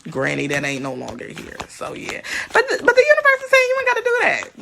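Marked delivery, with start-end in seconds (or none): 2.5-4.6 high, fast, emphatic; fast excited sermon. Acted. Preaching the universe's message.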